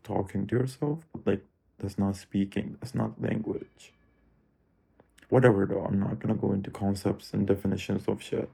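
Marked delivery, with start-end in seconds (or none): none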